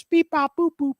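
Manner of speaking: high electronic beep-bop sounds; game beeps. Retold or imitated. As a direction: chirp it like a toy game. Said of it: "I had that game with the colors".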